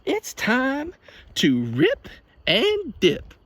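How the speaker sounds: high, hammed-up grandma pitch; mock grandma ad voice. Imitating the grandma selling the pizza, hammed up. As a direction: sell it like the grandma. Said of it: "she's like".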